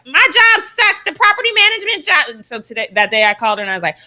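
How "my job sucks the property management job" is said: high mock-whiny complaint; imitating a high whiny complaint to mom; whining. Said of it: "call my mom and be like".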